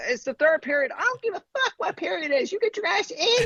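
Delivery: very high-pitched scolding screech; hammed-up mother losing her patience; angry mom yelling.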